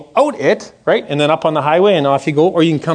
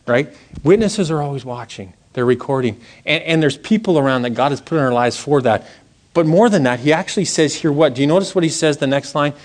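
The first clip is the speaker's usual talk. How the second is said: ordinary talk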